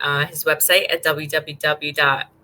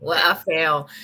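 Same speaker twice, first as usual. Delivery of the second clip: ordinary talk, nothing marked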